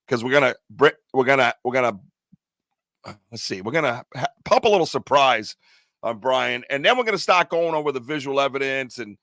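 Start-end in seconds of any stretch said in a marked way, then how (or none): none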